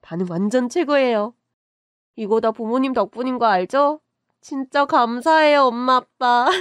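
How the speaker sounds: beam with thanks to mom and dad; excited thanks. Acted. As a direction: daughter gushing thanks to her parents. Daughter thanks parents joyfully.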